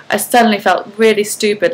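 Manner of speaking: ordinary delivery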